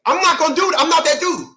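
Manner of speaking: high-pitched insistent protest; emphatic refusal. Real, not acted. Man refusing to play the game, high-pitched protest. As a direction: push both refusals right up in pitch.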